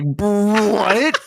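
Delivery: high, loud drawn-out what; incredulous reaction. Retold or imitated. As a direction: blurt it in exaggerated disbelief. Retelling own shocked reaction.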